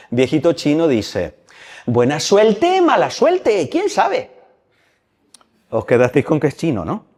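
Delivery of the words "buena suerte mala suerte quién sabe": a lightly put-on old Chinese man, higher; sing-song old Chinese man, calm and wise. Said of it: "Por eso habla así". (voz de viejito chino, más aguda y cantarina)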